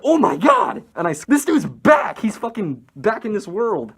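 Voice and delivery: loud, high cry of amazement; big reenacted excitement; retold elated shout. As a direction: exclaim it like a miracle just happened. Retells excitement at friend returning.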